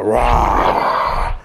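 long, drawn-out growling roar; imitated roar. Speaker imitating the roar that wipes darkness away, roaring. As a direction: let out a slow imitation roar. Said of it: "growl or roar".

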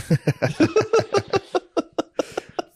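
long laugh that climbs way up; high wheezing laugh. Real, not acted. Friend cracking up at the drug addict bartender, high laugh. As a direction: break into a high cackle.

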